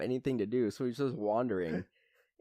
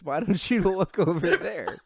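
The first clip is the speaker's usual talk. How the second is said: acted line breaking into laughter; higher and louder, cracking up mid-quote; laughing mock quote; 6 semitones higher, 7 dB louder than usual. Cracks up voicing the townsman's line.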